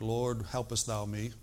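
restrained, understated mock-formal prayer; mock Presbyterian tone. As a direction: pray it politely, with no urgency at all. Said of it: "in a Presbyterian tone like".

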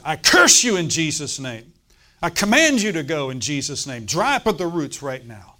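fierce faith command; commanding shout. Retold or imitated. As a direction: bark the rebuke at the growth. Preacher commanding growth to leave.

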